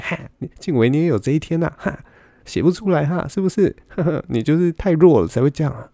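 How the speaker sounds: high, singsong mocking gloat; a retold friend's high mocking jeer; gloating taunt. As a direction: needle him with a high, teasing crow. Friend gloating over blocked comedian.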